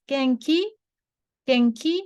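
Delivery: Genki, genki rising at the end, asking; questioning Genki with upward tune. Shown as a demonstration. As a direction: let Genki climb as a question. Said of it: "like this".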